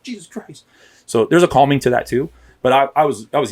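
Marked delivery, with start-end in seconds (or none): 0.0-0.6 mock alarmed reaction. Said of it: "you're all out of control".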